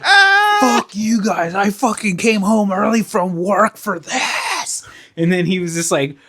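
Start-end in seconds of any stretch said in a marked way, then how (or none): 0.0-4.9 high, slow, strained tantrum voice; whiny high-pitched rage, hammed up; mock raging. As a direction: imitate a furious meltdown, dragging out the words. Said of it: "like, raging".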